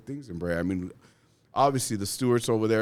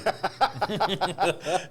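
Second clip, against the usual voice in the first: big laugh; 10 semitones higher than usual. Real, not acted. Laughing at his own story with the host. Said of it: "[name] hit campaign mode".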